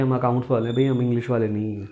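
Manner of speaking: ordinary talk, nothing marked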